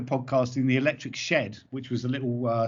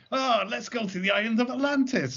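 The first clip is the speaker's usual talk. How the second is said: put-on high-pitched, silly drunken outburst; mock tipsy enthusiasm, pitched up; drunk improviser; 9 semitones higher than usual. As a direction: imitate yourself drunkenly blurting a wild idea. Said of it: "more and more drunk".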